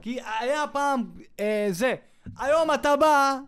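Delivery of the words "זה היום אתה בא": normal talking voice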